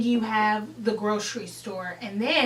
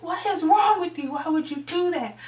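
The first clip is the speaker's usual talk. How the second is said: higher-pitched mock scolding; retold angry scolding; 6 semitones higher than usual. Friend telling off the one who pushed, scolding. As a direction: scold in mild acted outrage. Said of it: "really going hard".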